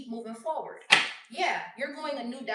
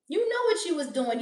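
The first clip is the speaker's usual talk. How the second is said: scolding; 6 semitones higher, 7 dB louder than usual. Real, not acted. Scolding the cheater.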